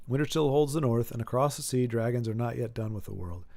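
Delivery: low, softening narration voice; dramatic read-aloud. Acted. Host reads an epic summary aloud, low narration. Reads the line out once more.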